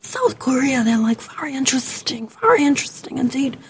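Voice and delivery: mock-mysterious whisper; put-on whisper. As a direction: whisper it as if intriguing, in play. Mock mysterious answer about job.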